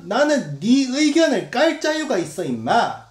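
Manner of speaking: high, loud, slow taunting; mock aggressive commenter; belligerent heckler. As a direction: jab at him like a bully. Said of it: "라고 착각을 하는데".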